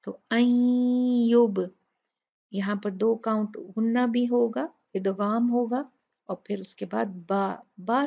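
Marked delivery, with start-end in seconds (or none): 0.3-1.7 slow melodic tajweed chanting; Quran recitation. Shown as a demonstration. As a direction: recite it slowly, tune held. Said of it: "नून साकिन के बाद". (धीमी तिलावत)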